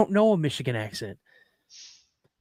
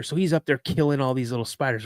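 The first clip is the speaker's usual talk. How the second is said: ordinary talk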